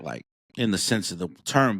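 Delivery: normal talking voice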